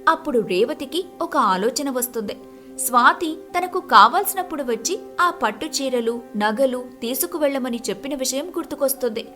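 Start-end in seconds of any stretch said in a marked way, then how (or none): none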